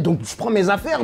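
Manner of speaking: ordinary delivery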